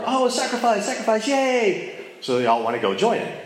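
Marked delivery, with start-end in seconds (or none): 0.0-2.0 high, giddy townsfolk cheer; mock cheering crowd. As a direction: cheer like delighted villagers.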